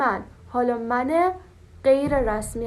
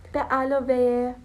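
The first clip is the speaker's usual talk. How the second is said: ordinary delivery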